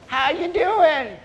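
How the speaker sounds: put-on high, drawn-out old-timer voice; old man. Old man greeting two hunters, high old-timer voice. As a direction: draw it out high, like a nosy old granddad.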